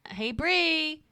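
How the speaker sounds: high, loud sing-out with a drawn-out name; calling out. Retold or imitated. Woman knocking on her friend's door, calling out. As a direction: imitate calling through a closed door.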